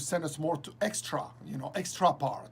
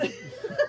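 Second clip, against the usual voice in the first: short high giggle; high laugh. Real, not acted. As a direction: giggle at the memory. Laughing at memory.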